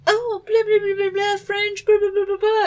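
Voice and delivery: very high mock-French babble; pretentious Francesca. Francesca showing off her French, imitated as high pretentious babble. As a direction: chatter away in a silly, high, snooty voice. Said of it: "That was very pretentious of her".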